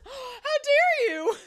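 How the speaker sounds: high, slow, loud scandalized; mock offended. Shin snubbed by the system, slow scandalized mock outrage. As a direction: drag it out, deeply insulted.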